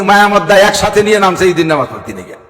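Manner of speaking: usual speaking voice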